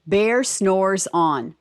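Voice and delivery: slow, deliberate title reading; teacher reading the book title slowly. Shown as a demonstration. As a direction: read the title slowly, word by word. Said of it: "Read the title".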